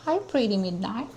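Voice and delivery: high, soft singsong pet talk; soft cooing. Real, not acted. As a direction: coo quietly to a pretty cat. Admiring her cat at the clinic.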